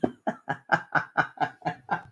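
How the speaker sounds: long, airy run of chuckles; real giggling. Real, not acted. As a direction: keep chuckling, mostly on breath. Laughing at money comment.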